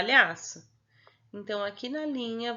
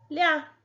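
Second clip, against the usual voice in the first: "Lhá" alone, higher and louder; isolated syllable; 6 semitones higher, 5 dB louder than usual. Shown as a demonstration. Teacher fills the last box, "Lhá" sounded out firmly. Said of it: "você vai colocar uma sílaba".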